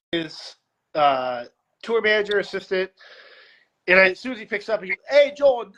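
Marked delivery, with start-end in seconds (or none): 5.1-5.7 lightly imitated British greeting, higher and louder; high, loud mock-British hello; British accent. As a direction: hail a friend in a hint of a British accent. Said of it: "like, the accent or whatever".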